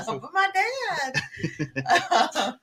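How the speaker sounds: giggling through the words; laughing. Real, not acted. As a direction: say it while cracking up. Laughing while joking.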